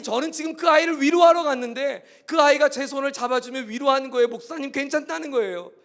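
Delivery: loud, high, urgent sermon voice; impassioned preaching. Acted. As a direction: preach it with full force. Preaching at full force.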